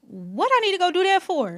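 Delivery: high, loud incredulous objection; hammed-up reluctant pushback; mock protest. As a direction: act out balking at being told what to do. Acts out her old reluctant self.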